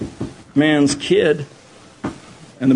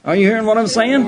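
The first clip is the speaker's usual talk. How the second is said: ordinary talk, nothing marked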